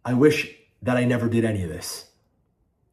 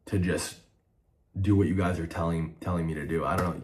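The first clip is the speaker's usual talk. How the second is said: dejected; 5 semitones lower, 6 dB quieter than usual. Real, not acted. Hurt, deflated after outburst.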